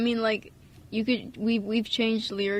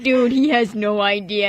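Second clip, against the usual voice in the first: slow, louder smug remark; slow, louder mock-smug aside; knowing aside; 8 dB louder than usual. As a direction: say it like a smug insider. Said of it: "And then I'm like".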